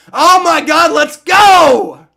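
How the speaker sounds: ordinary delivery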